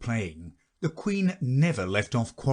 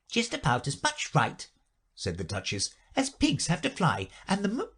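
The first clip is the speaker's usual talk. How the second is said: Duchess voice; 7 semitones higher than usual. A narrator's reading.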